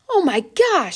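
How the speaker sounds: high, startled cry of surprise; excited yell. A narrator's reading. Girl spotting someone in the red balloon, excited cry. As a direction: gasp it out as she spots him. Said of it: "she yelled".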